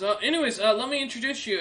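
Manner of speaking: put-on high young man's voice; young soldier. Young soldier about to introduce Nazarick, high and brisk. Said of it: "as he looks about and goes".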